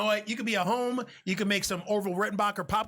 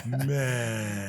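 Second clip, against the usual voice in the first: deep bass voice; 8 semitones lower than usual. Pretend. Echoing the bass voice.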